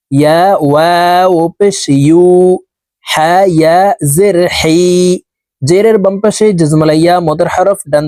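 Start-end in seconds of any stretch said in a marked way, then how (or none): none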